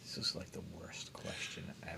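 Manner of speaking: unvoiced whispered aside; whispering. Real, not acted. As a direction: whisper it under your breath. Aside complaint about quiz question.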